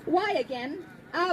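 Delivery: normal talking voice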